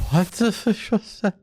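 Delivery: high, startled outburst; shocked reaction. Real, not acted. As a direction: react in disbelief.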